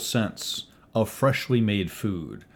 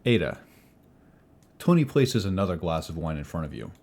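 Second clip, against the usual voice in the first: ordinary talk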